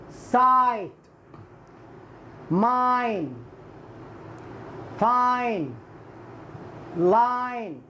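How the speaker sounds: سايت, ماين, فاين, لاين with drawn-out "eye"; letter-name I sound. Shown as a demonstration. Teacher recaps the silent E rule, long "ai" vowels. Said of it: "كما هو ينطق حرف آي".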